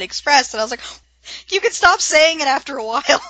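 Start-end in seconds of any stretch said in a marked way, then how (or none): 1.5-3.2 louder, higher exasperated plea; fed up with hearing it, half joking; mock exasperation.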